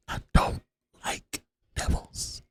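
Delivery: slow, whispered "like devils"; hushed aside. Real, not acted. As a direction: drop to a breath. Muttered aside about police.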